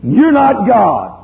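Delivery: high, loud, slow declaration; preacher's forceful reminder; slow emphatic rebuke. Real, not acted. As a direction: land every word.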